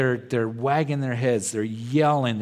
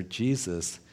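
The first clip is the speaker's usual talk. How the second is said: low, softened answer; more quiet; 5 semitones lower, 5 dB quieter than usual. Retold or imitated. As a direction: imitate a subdued, humbled reply. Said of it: "more quiet".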